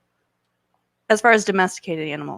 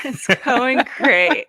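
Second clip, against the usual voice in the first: slow, laughing through it; 5 semitones higher, 6 dB louder, much slower than usual. Real, not acted. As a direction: laugh the words out slowly. Joking answer, laughing.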